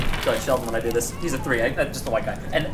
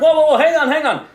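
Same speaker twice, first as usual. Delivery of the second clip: loud, high "whoa" commands; police halt; 9 semitones higher, 7 dB louder than usual. Acted. Police officers halting the demon vendor, loud and high. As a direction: stop her in her tracks.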